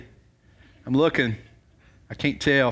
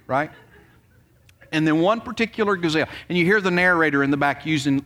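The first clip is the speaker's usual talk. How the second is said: ordinary delivery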